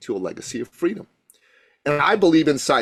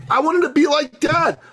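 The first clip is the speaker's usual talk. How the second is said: loud declaration; 12 semitones higher, 3 dB louder than usual. Real, not acted. Proud son declaring his wish.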